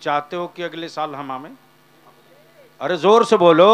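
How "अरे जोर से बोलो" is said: much louder call to the crowd; booming call for a loud reply; loud urging. Real, not acted. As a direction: shout for the crowd to answer. Preacher urges crowd to answer.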